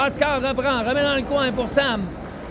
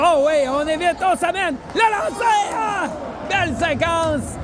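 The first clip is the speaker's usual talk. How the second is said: excited sports commentary; 6 semitones higher than usual. Real, not acted. Shot on goal, commentator shouts.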